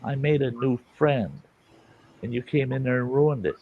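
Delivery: ordinary talk, nothing marked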